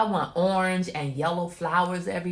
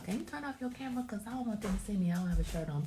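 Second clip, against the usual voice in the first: higher-pitched mock request; imitating her son; 4 semitones higher, 4 dB quieter, much faster than usual. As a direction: mimic the boy's plea in a raised pitch. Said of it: "He trying to walk through here".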